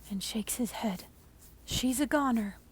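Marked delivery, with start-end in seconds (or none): 1.7-2.6 a bit louder, flat verdict; mild mock yell. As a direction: call it out, half-hearted yell. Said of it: "he yells to the hazmat team".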